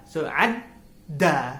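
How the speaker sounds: "ad" held back, then "da" popped out loud; held then released da. Shown as a demonstration. Vlogger teaching viewers the doubled consonant, holding then bursting on da. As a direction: hold "ad", pause, then hit "da" hard. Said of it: "I'm holding the da".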